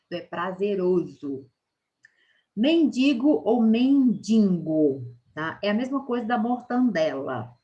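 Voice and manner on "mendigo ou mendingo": mendigo, then mendingo with an added n, slow and loud; right vs wrong form. Shown as a demonstration. Teacher contrasting right and wrong forms, slow. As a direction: draw out mendigo against mendingo. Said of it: "Tem gente que ainda fala mendingo".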